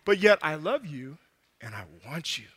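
soft, low, almost breathed; tender love. Acted. God telling the foolish swimmer he loves him, tender hush.

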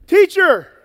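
loud, high call across a crowd; big projected shout; yelled. Acted. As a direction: yell it out to get the teacher's attention. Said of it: "He probably didn't have to yell".

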